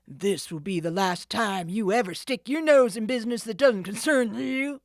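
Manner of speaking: loud rough warning; menacing threat. Acted. The thief warns Nancy, loud menacing voice. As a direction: loom over her. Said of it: "the man said threateningly".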